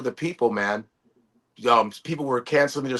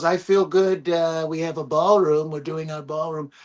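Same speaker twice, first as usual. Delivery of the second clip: lightly voiced Trump. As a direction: lightly imitate a boastful president. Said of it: "He goes".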